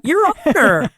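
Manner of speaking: high, loud, indignant Your Honor; whiny high protest. Retold or imitated. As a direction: squeal it like you can't believe it. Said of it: "He goes".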